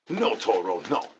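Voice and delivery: usual speaking voice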